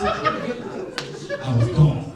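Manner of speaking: drawn-out through laughter; laughing. Real, not acted. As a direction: let the laugh swallow it. Recalling being very high.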